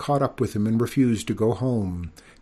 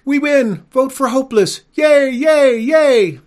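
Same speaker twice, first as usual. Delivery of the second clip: shrill, full-out triumphant cheering; shrieking princess; 12 dB louder than usual. A narrator's reading. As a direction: shriek the victory at the top of the voice. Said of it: "the princess shrieked".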